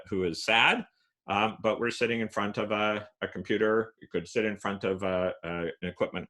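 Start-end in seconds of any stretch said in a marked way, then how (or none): none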